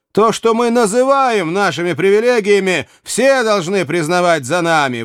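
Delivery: high, insistent declaration; emphatic exclamation. A narrator's reading. Archer defending guard privileges to the provost, exclaiming. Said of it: "воскликнул Каннингем".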